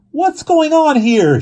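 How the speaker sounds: very high, loud, slow demand. Acted. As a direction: yell it, getting angry. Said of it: "she demanded".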